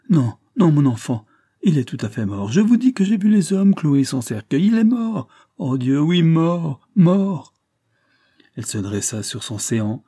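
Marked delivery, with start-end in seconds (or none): 0.1-7.5 high, loud feverish outcry; acted frantic delirium, insisting in anguish; delirious raving. A narrator's reading. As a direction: rave wildly, pitch climbing high and loud. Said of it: "elle recommença à divaguer".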